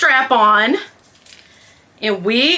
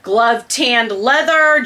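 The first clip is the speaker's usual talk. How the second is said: usual speaking voice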